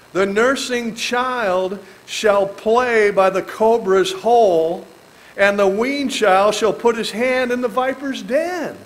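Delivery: emphatic scripture reading. Acted. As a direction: proclaim the verse slowly and loud.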